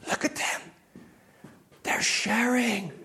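awed, soft whisper; secretive, marvelling undertone; hushed amazement. Retold or imitated. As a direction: whisper it like a rare sighting. Wife's awed report of children sharing.

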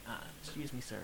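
timid, barely-there mock request; so quiet. Nervous store worker approaching a big customer, so quiet. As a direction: say it very softly, as if afraid to bother him. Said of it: "like, so quiet".

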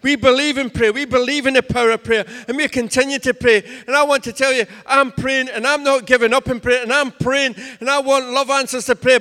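loud, driving preacher's cadence; full-force preaching. Acted. Pastor rallying the church to speak life, preaching at full force.

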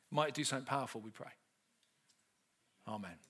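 low, hushed opening prayer to the Lord. Acted. Preacher opening the talk with prayer, low and hushed.

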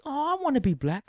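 high, sugary mock lady voice; sweet lady voice. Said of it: "she says".

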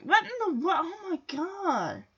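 hushed, high-pitched mock panic; mock freak-out. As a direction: imitate onlookers quietly freaking out. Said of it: "everyone around is, like, freaking out".

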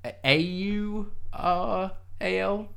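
spelling it out letter by letter, slowly; slow deliberate letter-by-letter spelling; spelled out. Shown as a demonstration. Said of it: "Or, like, as in oral?".

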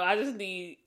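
ordinary delivery

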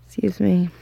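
usual speaking voice

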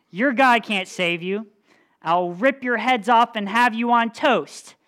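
hammed-up giant threat; menacing giant. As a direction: growl the threat like a bully giant. Giant threatens God's people.

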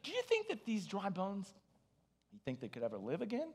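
quiet aside. Acted. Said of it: "God pulls him aside and says".

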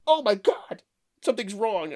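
high, disgusted quote of the wife; imitating disgusted wife. Wife tasting bad dressing, high and disgusted. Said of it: "She goes".

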